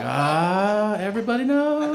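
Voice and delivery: high, rising comic wail; mock despairing wail. Patient seeing parents come into frame, mock despairing wail. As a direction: wail it upward as the secret gets out.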